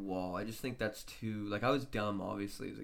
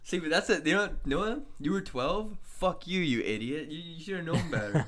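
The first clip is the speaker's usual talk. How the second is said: mock scolding; 5 semitones higher, 4 dB louder than usual. Mock scolding friend Noah.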